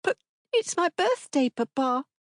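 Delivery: put-on high child voice, pleading; a small girl reminding him; hurt little girl. A narrator's reading. Daughter reminding Papa of her birthday, hurt.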